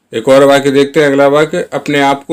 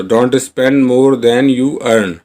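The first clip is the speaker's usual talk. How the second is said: slow model sentence; much slower than usual. Shown as a demonstration. Teacher modelling the English line for Hindi learners, very slow. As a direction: say each word slowly and clearly. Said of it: "teacher models full sentence".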